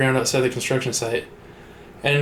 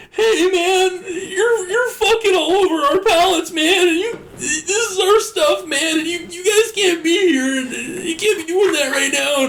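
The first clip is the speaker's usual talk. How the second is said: high strained whiny man, pitched way up; birthday guy voice; slower than usual. Retold or imitated. Security guy whining to the pallet couple in a high voice. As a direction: squeak out the complaint like a nervous worker. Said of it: "sounded like the birthday guy".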